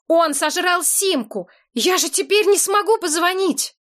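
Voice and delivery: shouting. A narrator's reading. Still yelling at the man.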